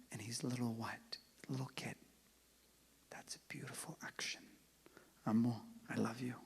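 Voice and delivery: very soft unvoiced whisper; tender whisper. Real, not acted. Uncle speaking fondly about his little nephew, whispering.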